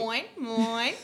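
Australian mine, vowel stretched to moin; Australian accent. Shown as a demonstration. Friend correcting the accent, Australian moin. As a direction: say moin like a real Aussie. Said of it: "mine, mine".

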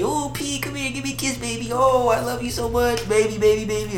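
high, soft lovey-dovey cooing; sweet nothings. Retold or imitated. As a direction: coo it like a lovestruck boyfriend. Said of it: "this is when I was lovey-dovey".

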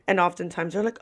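normal talking voice

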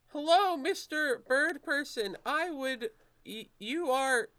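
high-pitched, awkwardly formal nervous greeter; Philip. Acted. Nervous man greets a bird person in the hall, high and halting. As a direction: pitch it way up and stumble over the greeting. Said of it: "he says".

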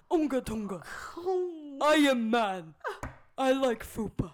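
loud dumb caveman, laughing. Retold or imitated. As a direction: boom it like a caveman. Caveman impression while laughing.

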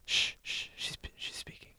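urgent hushed whisper; whisper, as if a spirit speaks. Acted. Hushing during seance.

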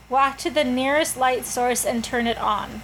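ordinary talk

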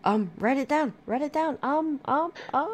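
high, loud squeaky chant. Pretend. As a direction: squeak it out as a gag.

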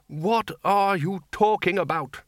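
high, slow, incredulous demand; acted exasperated outburst. A narrator's reading. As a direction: cut in exasperated, every word drawn out. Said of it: "the Arch-Chancellor demanded".

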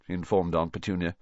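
ordinary delivery, nothing marked

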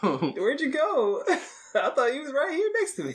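high, slow, giggling call; laughing mock husband. Husband searching for wife, high and laughing. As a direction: laugh out a lost husband's question.

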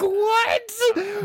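very high, loud cry of disbelief; drawn-out shrieked question; incredulous outburst. Retold or imitated. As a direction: shout it in hammed-up bafflement. Re-enacting his own baffled reaction.

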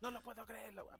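high, strained outraged screaming; hammed-up southern-accented yelling; furious shouting. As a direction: imitate an owner screaming in singsong southern outrage. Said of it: "Y igual empieza a gritar".

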